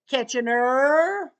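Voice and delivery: Kitchener stretched out slowly and loud; Kitchener taunt. Acted. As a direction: call out Kitchener like a fan. Repeats his Kitchener taunt.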